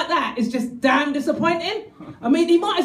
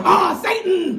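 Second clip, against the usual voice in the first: louder ah, Satan through a laugh; loud laughing reveal; laughing shouted reveal; 5 semitones higher, 4 dB louder, slower than usual. Shown as a demonstration. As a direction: cry it out gleefully. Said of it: "Seriously, check it out".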